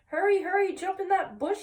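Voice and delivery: high urgent shout. A narrator's reading. Hector shouting to Wilbur as a dog chases him. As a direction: call it out high, fast warning. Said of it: "shouted Hector".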